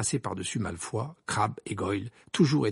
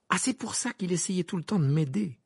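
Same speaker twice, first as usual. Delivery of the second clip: higher-pitched dawning realisation; exclaiming; 8 semitones higher than usual. Acted. Harry to the twins on understanding, exclaiming. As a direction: exclaim it as it dawns on you. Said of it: "s'exclama Harry".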